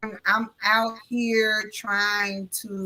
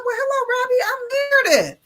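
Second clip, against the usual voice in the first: high, louder retold hello; chirpy introduction; 12 semitones higher, 4 dB louder than usual. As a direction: introduce yourself in a high social voice. Retold introduction to new acquaintance.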